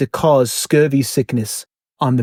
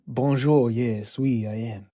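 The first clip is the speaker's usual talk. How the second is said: soft, mock French accent; 3 semitones lower, 7 dB quieter, slower than usual. Guest shows the host his new accent, soft French. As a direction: answer quietly in a put-on French accent. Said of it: "Are you happy with your new accent?".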